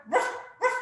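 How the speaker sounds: quick, high doggy ruffs; dog bark. Shown as a demonstration. Teacher hinting at the next pose, high playful barking. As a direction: bark twice like a little dog. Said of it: "The down dog".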